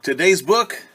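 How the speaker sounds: put-on bright, high, loud welcome to the kids; storytime host. Teacher opening storytime for children, bright and loud. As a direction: greet the children like a cheerful TV host.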